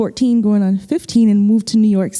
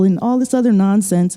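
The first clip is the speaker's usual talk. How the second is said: ordinary talk, nothing marked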